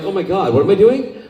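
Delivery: high, loud, laughing self-alarm; mock alarm. To the interviewer, retelling reading fan fiction, high laughing alarm. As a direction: squeal it like you caught yourself. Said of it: "I'm like".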